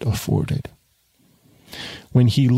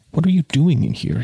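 higher, startled question; alarmed question; 6 semitones higher than usual. Acted. Husband alarmed at the closed closet door. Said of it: "he asked".

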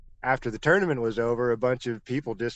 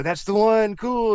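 impressed fan; 9 semitones higher, 4 dB louder, slower than usual. Retold or imitated. Fans admiring the albatross disc.

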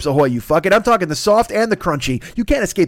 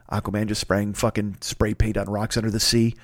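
ordinary talk

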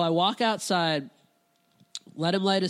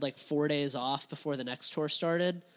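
normal talking voice

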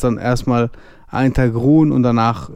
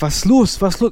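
higher, urgent retold questioning; alarmed; 6 semitones higher than usual. Boyfriend fears a burglar at her door, retold alarm. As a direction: press for an answer, mildly alarmed. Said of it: "die kriegt Panik".

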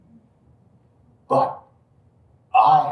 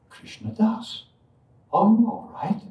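high, lightly voiced old lady; concerned old devotee; 11 semitones higher than usual. Old devotee checks on him, high imitated concern.

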